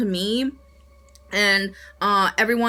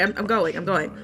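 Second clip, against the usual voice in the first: ordinary talk, nothing marked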